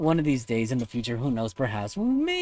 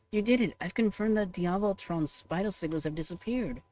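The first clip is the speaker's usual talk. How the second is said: higher, cheerful helper voice; upbeat assistant voice; 7 semitones higher than usual. Acted. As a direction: report the news brightly, like an eager aide. Reads a game character's dialogue.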